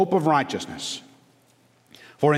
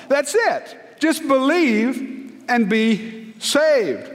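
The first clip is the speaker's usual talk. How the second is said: impassioned preaching; 7 semitones higher than usual. Acted. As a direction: preach it out with fire to the congregation. Preaching at full force.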